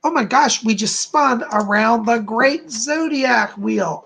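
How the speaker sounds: usual speaking voice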